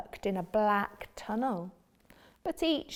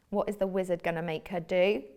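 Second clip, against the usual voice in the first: usual speaking voice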